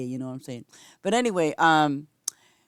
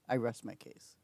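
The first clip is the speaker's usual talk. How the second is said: softer whispered verdict; 7 semitones lower, 13 dB quieter than usual. Real, not acted. Streamer closes the argument about Ace, whispering. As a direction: murmur it smugly to the chat. Smug aside to chat.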